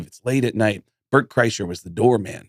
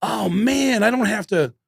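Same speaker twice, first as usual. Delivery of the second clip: realization; 6 semitones higher than usual. Retold or imitated. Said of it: "I was like".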